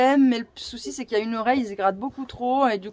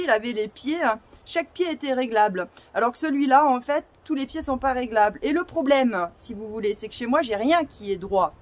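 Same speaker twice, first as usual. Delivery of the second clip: ordinary talk, nothing marked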